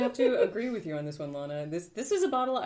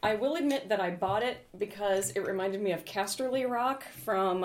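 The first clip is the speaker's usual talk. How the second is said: ordinary talk, nothing marked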